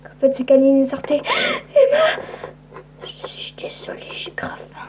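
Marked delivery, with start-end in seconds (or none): 1.8-2.2 cri apeuré. Acted. Cri d'une enfant apeurée. 3.0-4.9 chuchote, honteuse et affamée; chuchoté. Acted. Vampire affamée chuchote ses excuses.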